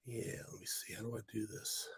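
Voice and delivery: breathy whisper under his breath; whispering to himself. Real, not acted. Streamer figuring out how to remove a commenter, whispering. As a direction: mutter it while working the controls.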